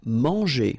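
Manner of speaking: mahn-zhay, nasal first vowel; French word. Shown as a demonstration. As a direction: say Manger the French way. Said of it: "remember here, nasal".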